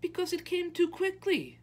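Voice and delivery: very high, loud, indignant; upset high-pitched outburst; protesting child. A narrator's reading. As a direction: argue back in a high, insistent voice. Child argues against the ending.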